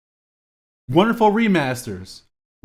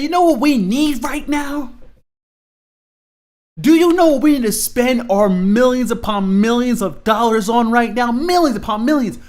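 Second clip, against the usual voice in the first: shouted executive quote; 7 semitones higher than usual. Retold or imitated. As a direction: bellow it like a greedy executive. Executives pitching at a board meeting.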